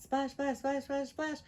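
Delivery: usual speaking voice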